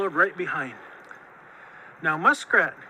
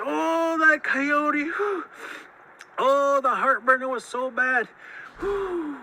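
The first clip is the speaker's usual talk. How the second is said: groaning in pain; 7 semitones higher, slower than usual. A narrator's reading. Monster clutches his burning chest, pained groaning. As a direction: moan and blow out through the heartburn.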